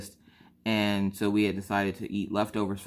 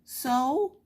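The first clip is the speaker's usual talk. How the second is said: put-on child voice. Daughter voiced in story.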